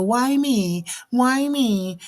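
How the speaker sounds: high, self-pitying whine; whiny voice. Retold or imitated. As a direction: whine it like a sulking victim. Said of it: "I make the whiny voice".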